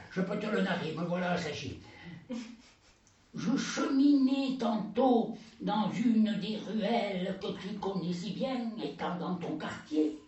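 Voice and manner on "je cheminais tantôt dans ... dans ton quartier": slow solemn recital. Acted. [name]'s slow narrated verse.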